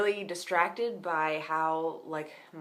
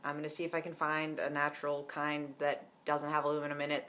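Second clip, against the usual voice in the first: usual speaking voice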